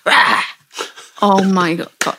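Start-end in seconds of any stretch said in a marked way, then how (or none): 0.1-0.4 sudden loud "rah" to startle; mock monster roar. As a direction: burst out like a body springing to life. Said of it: "and the body goes".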